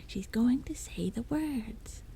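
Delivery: high, slow goblin whisper; high creepy goblin whisper. Acted. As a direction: hiss it eagerly like a little goblin.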